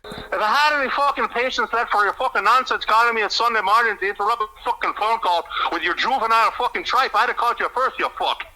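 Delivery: put-on high, ranting Irish accent. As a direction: scold in an exasperated Irish brogue. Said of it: "do your Irish accent quickly".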